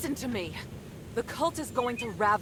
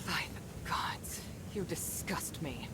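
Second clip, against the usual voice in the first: hissed under the breath in disgust; disgusted whisper; 3 semitones lower, 9 dB quieter than usual. Acted. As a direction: whisper it with contempt. Disgusted aside to rival lord.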